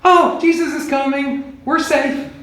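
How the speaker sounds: high, lightly mocking relief, lifted well above his own voice; relieved disciples. Disciples spotting Jesus from afar, high mock relief. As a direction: play a boatman sighing with relief. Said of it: "they'll be going".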